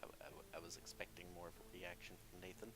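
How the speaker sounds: fast breathy whisper aside. Real, not acted. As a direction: whisper it quickly under breath.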